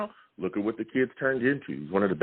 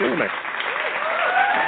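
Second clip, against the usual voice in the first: high, slow, stretched-out hype call; drawn-out announcer call; 6 dB louder, much slower than usual. Acted. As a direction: call it out like a game-show host.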